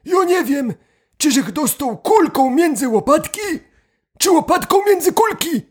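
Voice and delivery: put-on high old man voice. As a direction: play the second old grandpa. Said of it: "A drugi na to".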